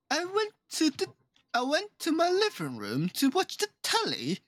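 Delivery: lightly posh British voice; high put-on British accent. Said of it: "stop being Brits".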